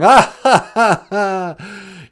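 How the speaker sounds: loud, high fake "ah ha ha"; hammed-up laugh of a whole crowd; mock group laughter. Everyone laughing at the wicked witch, loud mock laughter.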